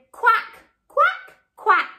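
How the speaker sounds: high quacking duck voice; high duck quacks from mummy duck. Acted. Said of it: "Mummy duck said".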